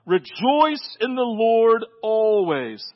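"Rejoice in the Lord always" stretched out slowly; slow proclamation of "Rejoice in the Lord always"; slow proclaiming. Shown as a demonstration. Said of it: "I will say it again".

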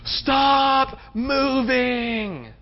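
high, hugely drawn-out cry; mock scream. Exhausted body to the runner who must push, mock screaming. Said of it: "everything is screaming at us".

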